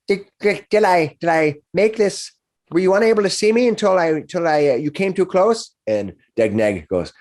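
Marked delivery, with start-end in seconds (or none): 0.1-5.7 put-on much higher, eager young orc voice; a nervous teenage orc calling out from hiding. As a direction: play an anxious young orc, pitched up high. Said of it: "you hear a young voice go".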